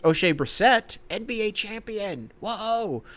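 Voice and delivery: high, drawn-out awe; champion roll call. Pretend. Host announcing a champion in the roster game, high-pitched hype. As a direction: proclaim it high, then a long amazed whoa. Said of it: "reading each name and saying".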